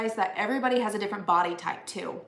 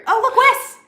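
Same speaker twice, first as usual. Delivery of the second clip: calling out, much higher and louder; excited call; 13 dB louder than usual. Real, not acted. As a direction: call out to Wes with delight. Spotting fiance, calling out.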